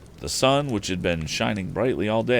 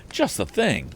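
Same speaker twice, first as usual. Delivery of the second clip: a lightly voiced glad cry, higher; cried out; 10 semitones higher than usual. A narrator's reading. Boy spotting a shed in the storm, mild glad cry. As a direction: pitch it up with sudden relief. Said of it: "cried the boy".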